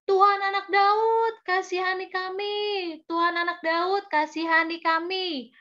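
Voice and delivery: high, pleading call, acted for children; storyteller's chanted cry for mercy. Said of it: "mereka berteriak-teriak".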